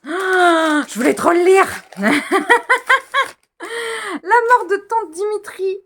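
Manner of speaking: high, loud shriek of delight; real excited squeal. Real, not acted. Reader unwrapping a long-wanted book, squealing with excitement. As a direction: squeal with joy and burst out laughing.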